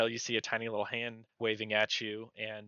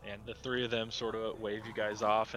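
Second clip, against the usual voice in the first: ordinary talk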